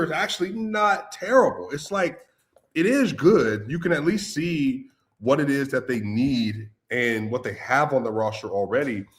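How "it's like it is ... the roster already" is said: ordinary talk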